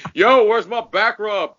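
high, loud, slow brash call; swaggering demand. Retold or imitated. A tourist arriving in Sweden demanding a back rub, brash and loud. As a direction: strut in and demand it. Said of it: "be like".

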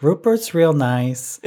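a light, slowed mock of Sandra being nice; Sandra's voice. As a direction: imitate Sandra being politely fake. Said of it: "And Sandra's like".

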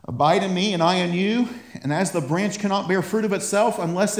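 fired-up preacher, high and loud; full-force preaching. Real, not acted.

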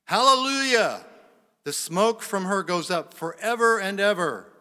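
high, ringing loud shout of praise; crying out. Acted. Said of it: "Once more they cried out".

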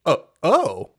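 high-pitched imitation of dawning surprise; genuinely surprised. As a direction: act out stumbling onto your own power. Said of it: "he's genuinely surprised about it as well".

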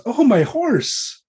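put-on high, slow voice of a young lady spotting her horse; delighted lady. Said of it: "And she says".

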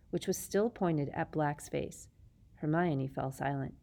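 ordinary delivery

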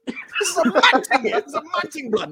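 high, hammed-up Jamaican accent. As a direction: put on a Jamaican accent, laughing. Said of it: "Hollywood sounding Jamaican".

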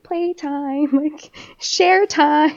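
slow, high singsong teacher voice; sweet preschool teacher announcing activities; kindergarten teacher voice. Shown as a demonstration. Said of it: "you don't wanna sound like a, a kindergarten teacher".

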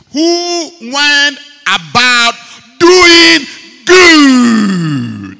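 loud, slow preaching cry; preaching shout. Real, not acted. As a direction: cry it out to the congregation. Preacher cries out climax.

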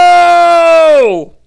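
long, loud, high "oh" howl; hammed-up rock-star wail, held long; melodramatic wail. Caiaphas acting like a rock star, mock-melodramatic wail.

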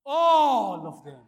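"All" very loud, high and stretched; stress on "All". Shown as a demonstration. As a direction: hold "All" long, then fall away. Correcting the deliberate misquote.

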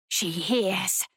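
low, hissing creature voice; hissing predator. A narrator's reading. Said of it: "a hissing predator".

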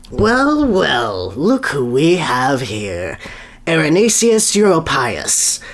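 high first well, then slow, amused; a pleased scientist finding a hedgehog; kindly man's voice. Acted. Kindly man greeting Sonny, slow and amused. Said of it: "mustachioed man".

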